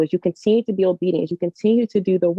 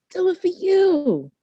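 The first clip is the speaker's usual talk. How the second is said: higher, leaning hard on you; emphatic poem line; 12 semitones higher than usual. Acted. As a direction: land the payoff on you.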